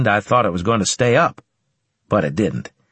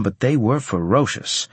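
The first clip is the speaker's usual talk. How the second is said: ordinary delivery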